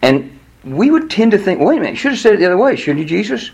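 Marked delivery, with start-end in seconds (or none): 1.6-3.5 puzzled objection. Acted. Voicing listeners objecting to Jesus.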